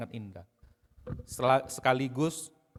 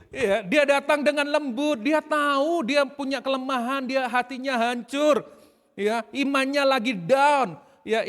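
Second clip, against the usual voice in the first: much higher, loud, slow sermon build; full-force preaching; 9 semitones higher, 9 dB louder, slower than usual. Acted. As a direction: proclaim it passionately to the congregation. (khotbah berapi-api, keras dan lambat)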